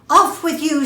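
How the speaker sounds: loud send-off. Acted. Stag sends Elk off.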